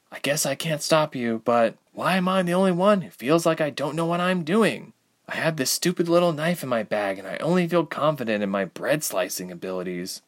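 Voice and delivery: high, unforceful self-doubting voice; Ilse, downcast and unsure. A narrator's reading. Ilse admitting doubts to Reshma, downcast. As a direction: confess helplessness after a cry. Ilse's voice after crying.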